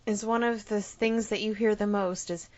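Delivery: ordinary talk, nothing marked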